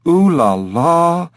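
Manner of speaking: high, drawn-out sarcastic trill of words; mock them with slow, high French flair; mocking ooh-la-la. Parrot mocking talk of France.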